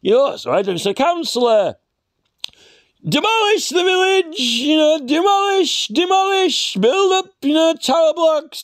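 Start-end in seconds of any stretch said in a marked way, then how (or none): 3.0-8.6 high, gleeful voice; mock developer. Council planner mocking the village, high and gleeful. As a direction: gloat like a greedy council developer.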